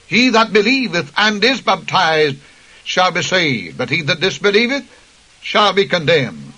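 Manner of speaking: normal talking voice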